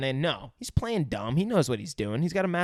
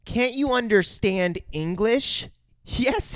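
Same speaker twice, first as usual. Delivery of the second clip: high, slow mock-exasperated child; Drew; 8 semitones higher, slower than usual. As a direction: spell it out for someone slow. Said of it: "She says".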